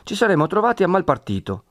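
Perpetua, brisk. A narrator's reading. Perpetua continues.

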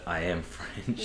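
put-on slow, quiet French-accented line. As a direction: drawl it like a snooty maitre d'. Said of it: "like Marco said, the line".

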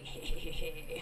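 hushed cop voice. Retold or imitated. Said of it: "they're like".

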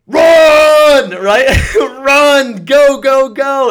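high, loud frantic "run, go"; urgent shouting. Acted. Host urging listeners to flee like a gazelle, loud high shout. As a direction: shout them into running for their life.

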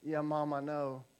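put-on low, soft, guilty little boy; sheepish kid. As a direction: mumble like a stuffed, sheepish kid. Son answers with full stomach.

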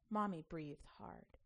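usual speaking voice

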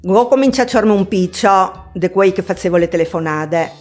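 ordinary talk

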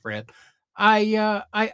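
ordinary delivery

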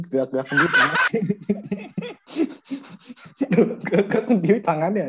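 bicara sambil terbahak; tertawa. Real, not acted. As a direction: tawa lepas di tengah kalimat. Menertawakan tangan teman bergerak. (sambil tertawa geli)

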